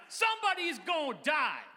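high, loud dramatic call; big booming prompt for the crowd; said with your chest. Shown as a demonstration. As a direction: shout it out like a city preacher. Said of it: "Let's do that one more time".

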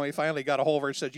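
usual speaking voice

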